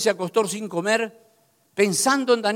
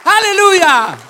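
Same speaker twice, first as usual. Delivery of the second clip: high, loud, slow fervent exhortation; preaching at full force; 8 semitones higher, 11 dB louder, slower than usual. Acted. Preacher urging the congregation to stay pure, loud and fervent. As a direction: drive every word home to the congregation.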